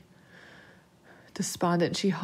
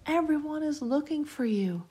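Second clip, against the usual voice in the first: put-on high child's voice; little boy telling his friend; young boy; 8 semitones higher than usual. A narrator's reading. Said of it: "Billy asked".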